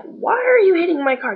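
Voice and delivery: retold shock at a man hitting your car; mild mock alarm; alarmed question. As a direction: pitch jumps high in disbelief. Alarmed at the punching.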